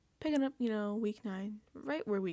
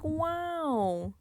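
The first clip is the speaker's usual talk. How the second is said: mock wow; 6 semitones higher than usual. Jurors amazed by party.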